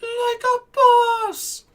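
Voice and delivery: very high, drawn-out, loud catchphrase yell; Jacksepticeye. Retold or imitated. Mimicking a YouTuber's excess energy, slow high-pitched yell. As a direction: stretch it into a piercing hyper shout. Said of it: "That man had so much excess energy".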